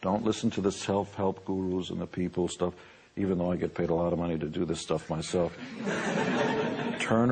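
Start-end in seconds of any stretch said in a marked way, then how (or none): none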